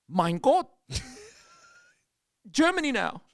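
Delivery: loud, high-pitched comic German; mock German accent. Tourist walking into Germany at Epcot, hammed-up German accent. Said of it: "I'm doing my worst French accent and British accent".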